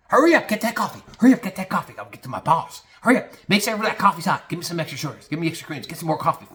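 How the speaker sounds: high, panicky hurrying voice; mock an underling urgently chasing coffee for the boss; frantic Bobby. Bobby frantically demanding coffee at deli.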